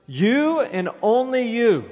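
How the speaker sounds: high, loud, slow stress on you and only you; emphatic you. Acted. Preacher pressing the emphatic pronoun on disciples, stressed. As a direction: lean hard on "You and only you", each you high and drawn out. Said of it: "Jesus is saying to those who would call themselves disciples".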